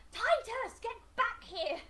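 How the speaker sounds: very high, strained-pitch call; high holler at moderate volume; acted yell. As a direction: yell to bring someone back. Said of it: "You're yelling even further".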